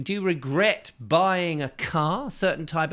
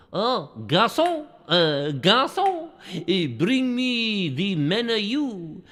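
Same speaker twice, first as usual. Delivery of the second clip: high, slow hammed-up French accent; mock French waiter call; 7 semitones higher, slower than usual. Host playing a diner calling the waiter, hammed-up French accent. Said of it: "sit there and go".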